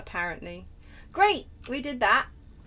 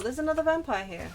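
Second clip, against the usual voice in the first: normal talking voice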